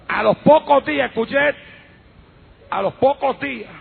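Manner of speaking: normal talking voice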